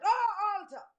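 loud "Oh, altar"; mild preacher's shout; shouted call. Acted. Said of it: "he shouted".

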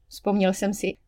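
ordinary delivery, nothing marked

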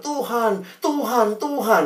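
mock-spiritual sighing of Tuhan; a pious student lightly parodied. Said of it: "kalau ngomongnya tuh rohani banget".

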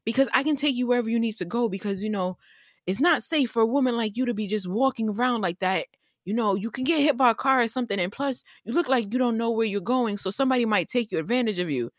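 high, insistent good-Samaritan voice; imitated helpful stranger. Pickup driver insisting on helping a lone walker, high eager imitation. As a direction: keep it high and quick, pressing to help. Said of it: "And he was like".

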